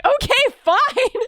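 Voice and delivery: high, emphatic mock agreement; mom giving in. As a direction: give in as an astonished mother. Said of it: "she was like".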